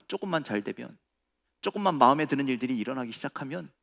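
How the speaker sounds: ordinary talk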